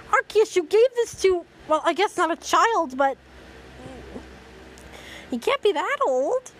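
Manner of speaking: high-pitched, exasperated disbelief; incredulous protest. Real, not acted. Reader questioning Arceus's choice of a young boy, incredulous. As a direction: object with the voice shooting up.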